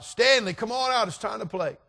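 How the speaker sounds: high, loud call to a playmate. Retold or imitated. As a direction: holler like kids at the door. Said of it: "they called it out".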